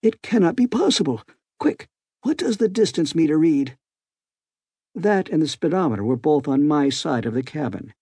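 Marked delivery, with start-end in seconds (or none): 0.0-3.8 cried out. A narrator's reading. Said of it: "he cried".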